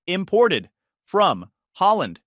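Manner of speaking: slow, loud, Imported from Holland stretched word by word; slow emphatic retort. Acted. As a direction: drag out Imported from Holland to win the argument. Joyce repeats retort.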